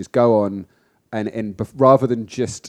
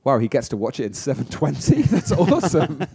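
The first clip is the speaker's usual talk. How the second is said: laughing; 5 semitones higher than usual. Retold or imitated. Mock envy over 720p.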